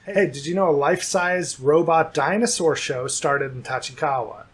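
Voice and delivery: ordinary talk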